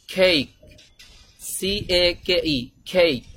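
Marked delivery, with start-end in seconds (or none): 0.1-0.5 কেক as "kheik", puffed k and gliding vowel; British-American pronunciation. Shown as a demonstration. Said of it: "তখন তো তারা কেক বলবে". 2.8-3.2 কেক as English "cake", with aspirated k; British-American pronunciation. Shown as a demonstration. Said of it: "তখন তো তারা কেক বলবে".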